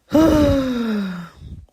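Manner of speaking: loud, drawn-out exhale; long sigh. Real, not acted. Podcaster wrapping up a heavy topic, long sigh. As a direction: let out a big long sigh.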